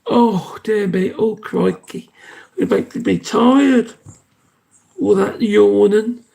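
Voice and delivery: fond pet talk, high and slow; cooing at pet. Real, not acted. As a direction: melt over his yawns, drawn out. Cooing over the yawning pet.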